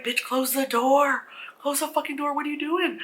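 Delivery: high-pitched exasperated urging; exasperated scolding. Retold or imitated. Viewer urging film girl as Mommy climbs stairs, exasperated. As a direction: scold her in high, mock-frantic disbelief. Yelling at film character from audience.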